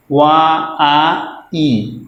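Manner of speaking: वा आ ई, each vowel sound drawn out on its own. Shown as a demonstration. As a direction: stretch वा आ ई vowel by vowel. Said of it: "व्हाइट में दो sound है".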